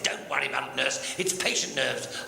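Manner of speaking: low, unhurried doctor voice; calm doctor, pitched well down; reassuring doctor. Acted. Doctor reassures flustered nurse in joke.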